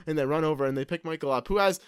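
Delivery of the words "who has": plain reading voice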